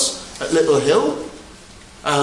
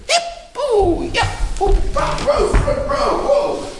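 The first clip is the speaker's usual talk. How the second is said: high excited whoops; slower than usual. Real, not acted. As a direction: whoop high with laughter at each row. Cheering on the rows.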